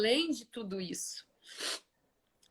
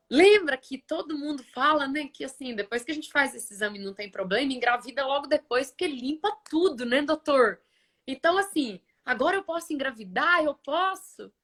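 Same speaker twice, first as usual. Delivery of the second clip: high, hurried, hopeful questioning; retold eager pleading; 5 semitones higher than usual. Patient asks doctor if she can get pregnant, eagerly. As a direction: re-enact an excited patient rushing to ask.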